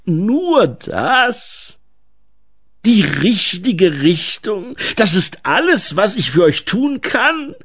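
theatralischer Gnom, hoch und gedehnt; Waldgnom, theatralisch. Acted. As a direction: spiel einen übertrieben dienstfertigen Gnom. (theatralische Gnomenstimme)

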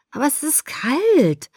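petulant complaint about the cold; whining. Acted. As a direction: grumble it like a sulking teen. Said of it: "maulte ich".

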